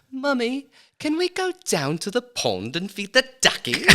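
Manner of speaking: put-on high-pitched posh British kid; posh British child. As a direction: imitate a little child asking mummy in a posh British accent. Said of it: "I had a British accent".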